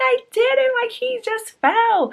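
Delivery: high, loud pleading protest, slowed; defensive self. Retold or imitated. Owner defending herself to brother, high pleading voice. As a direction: plead innocence in a squeaky voice. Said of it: "I was like".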